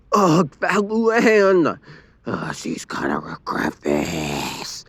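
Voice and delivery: seethe through the words; frustrated growl. A narrator's reading. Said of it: "Lincoln growled in frustration".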